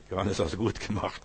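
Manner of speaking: very soft, gentle praise; tender praise. Retold or imitated. Jesus praises Johannes tenderly on his lap, softly. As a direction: speak it softly like a loving father. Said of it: "er streichelt mich und sagt".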